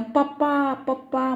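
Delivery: put-on child bursting into a wail, calling papa; a hint of a toddler's wail, lightly voiced. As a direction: cry out papa twice like a hurt toddler. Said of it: "म्हणून गळा काढत".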